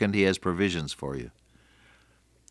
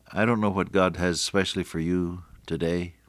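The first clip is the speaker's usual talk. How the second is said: ordinary talk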